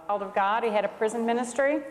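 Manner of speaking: usual speaking voice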